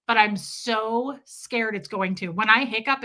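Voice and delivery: ordinary delivery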